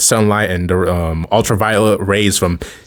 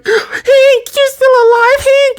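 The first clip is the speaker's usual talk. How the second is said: much higher, sobbing cry of relief; 4 dB louder, slower than usual. Retold or imitated. Wasp hearing her husband is alive, sobbing and high. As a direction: wail his name through tears. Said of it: "and she was crying".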